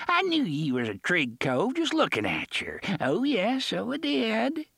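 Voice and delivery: high, merry pirate; cheerful pirate. A narrator's reading. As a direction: chirp it like a happy buccaneer. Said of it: "cheerful once more".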